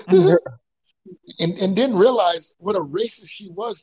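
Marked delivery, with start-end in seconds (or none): 0.0-1.5 laughing. Real, not acted. Laughing while telling.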